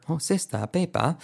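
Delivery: British "sista, peipa" demo. Shown as a demonstration. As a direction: drop the final R, British-style. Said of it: "británica".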